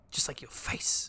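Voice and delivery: snide whisper. Real, not acted. Snide aside at game character.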